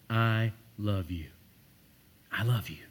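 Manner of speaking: gentle loving declaration; tender I love you. Shown as a demonstration. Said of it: "the greatest".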